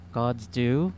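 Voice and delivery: usual speaking voice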